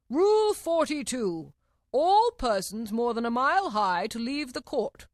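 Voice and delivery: slow, high, loud royal proclamation; reading out a rule. A narrator's reading. King reads out from his book, loud proclamation. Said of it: "he read out from his book".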